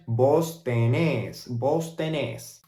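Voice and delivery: slow "vos tenés", stressed on "nés"; Argentine vos form. Shown as a demonstration. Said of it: "then Argentine Spanish".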